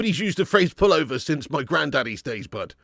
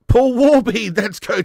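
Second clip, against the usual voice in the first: big, slow, drawn-out name call; excited shout-out; 7 semitones higher, 7 dB louder, slower than usual. Real, not acted. Streamer hyping a year-long member to chat, excited call. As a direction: belt the member's name like an announcer.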